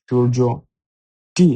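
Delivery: usual speaking voice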